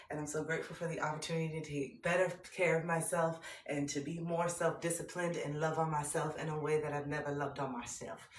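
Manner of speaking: usual speaking voice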